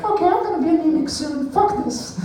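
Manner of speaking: put-on very high-pitched girly falsetto; girls' voice. Girls reacting to the weight loss belt, high-pitched falsetto. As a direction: put on a high mock-girl voice. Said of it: "girls going like".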